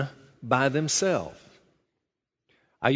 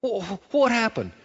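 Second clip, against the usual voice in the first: put-on confused little boy; 7 semitones higher than usual. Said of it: "Looks up at you and goes".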